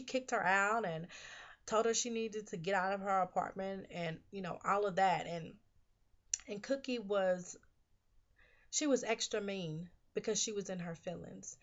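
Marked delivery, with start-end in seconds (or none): none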